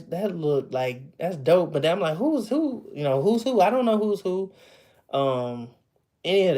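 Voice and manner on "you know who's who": ordinary talk, nothing marked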